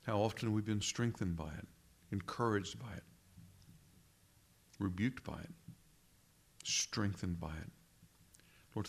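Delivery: reverent, measured prayer voice. Acted. Pastor praying for absent brethren, reverent prayer voice. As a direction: pray for the brethren with quiet reverence.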